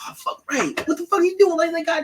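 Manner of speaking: high-pitched mock panic. Friend voicing Finn panicking over Chewie, high mock panic. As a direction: squeal it in pretend alarm.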